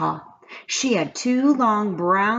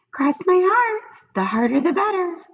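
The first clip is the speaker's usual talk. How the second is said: pigtail voice; 12 semitones higher than usual. A narrator's reading. The pigtail coaxes Paul in a high little voice. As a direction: coax him like a tiny tempter.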